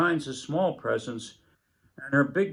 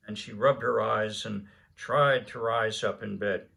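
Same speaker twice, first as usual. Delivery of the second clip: ordinary delivery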